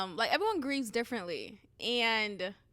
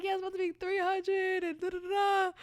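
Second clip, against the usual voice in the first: higher, hurried flustered voice; 8 semitones higher than usual. Retold or imitated. Telling her cousin the tire cost, flustered. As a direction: rush it out, flustered. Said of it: "I was like".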